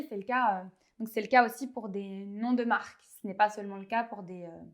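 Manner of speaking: ordinary talk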